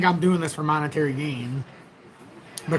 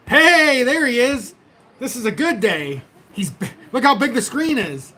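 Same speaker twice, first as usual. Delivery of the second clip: much higher and louder cheerful "hey"; excited greeting; 6 semitones higher, 3 dB louder than usual. Retold or imitated. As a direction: burst in like it's a great day. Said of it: "you come on and you're like".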